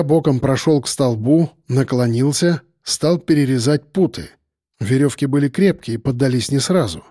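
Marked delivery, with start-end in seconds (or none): none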